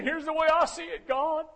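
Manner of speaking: high, loud preaching as Elijah complains; Elijah pouring it out. Acted. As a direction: let it all out, rising and shouting.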